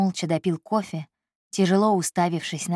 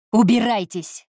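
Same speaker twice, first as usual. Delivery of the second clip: a short, raised bark; 4 semitones higher, 5 dB louder than usual. A narrator's reading. Boss ordering his secretary out, barked. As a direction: snap the order out. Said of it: "рявкнул Кристиан".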